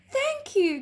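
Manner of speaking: put-on high bright child thanks; grinning girl. A narrator's reading. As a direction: thank him with a grin. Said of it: "grinned Lottie".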